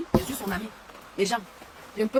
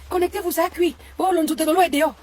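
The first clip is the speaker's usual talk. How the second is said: mocking imitation; 6 semitones higher, 4 dB louder than usual. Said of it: "Fleur de Lys disait".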